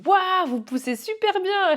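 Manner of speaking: high, slow amazed praise; drawn-out high wow; impressed praise. Retold or imitated. Midwife praising the mother's pushing, high and amazed.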